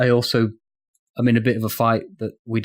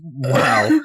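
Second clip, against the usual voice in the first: laughing. Real, not acted. Laughing at friend's read.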